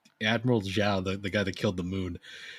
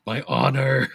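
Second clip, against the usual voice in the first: loud, higher mock-dramatic cry; overwrought melodramatic actor; 4 semitones higher, 6 dB louder, slower than usual. Host mimics the parody play's Zuko, melodramatic. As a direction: cry it out like an over-the-top stage actor. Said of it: "he's just".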